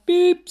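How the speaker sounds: high, loud beep; horn beep. Acted. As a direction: honk it bright and high. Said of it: "said Blue".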